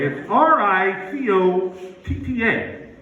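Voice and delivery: letters R I C O T T A spelled slowly one by one. Shown as a demonstration. Comic spelling out ricotta for the crowd, slow. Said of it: "I said".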